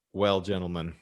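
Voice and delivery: normal talking voice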